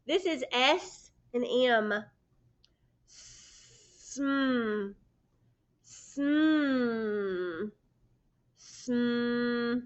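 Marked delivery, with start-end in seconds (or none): 3.1-9.8 Sm with lips closed, humming; held sm blend. Shown as a demonstration. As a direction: press the lips and hum sm. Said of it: "Hold your lips together".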